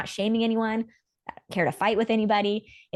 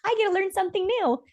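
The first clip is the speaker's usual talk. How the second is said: high, excited imitation of her partner; imitating Rain; 10 semitones higher than usual. Voicing Rain receiving new information: high, eager. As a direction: squeal it high like a delighted discovery. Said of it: "she'll literally get information".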